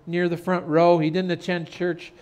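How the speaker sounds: ordinary talk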